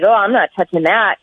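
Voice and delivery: normal talking voice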